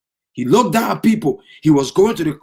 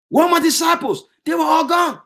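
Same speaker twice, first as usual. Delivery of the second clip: pitched up high, crying out; anguished cry; 8 semitones higher than usual. Acted. Jesus crying out that his disciples are gone, anguished. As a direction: call out the loss, voice rising. Jesus cries out, repeated louder.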